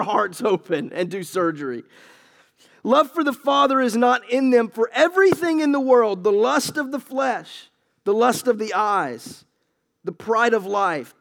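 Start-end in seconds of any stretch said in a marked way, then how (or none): 2.8-11.2 higher, deliberate proclamation; preached scripture. Acted. Preacher reading the list of worldly lusts to the church.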